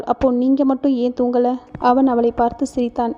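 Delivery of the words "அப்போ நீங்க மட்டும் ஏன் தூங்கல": ordinary delivery, nothing marked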